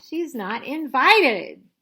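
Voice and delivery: loud, firm no, slow at the end; loud refusal. Acted. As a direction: shut her down flatly and loudly. Refusing sister loudly.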